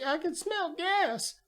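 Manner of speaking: high, slow put-on voice; imitated doctor. Rescued doctor warns the cops, high imitated voice. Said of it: "and she's like".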